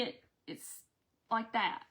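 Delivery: ordinary talk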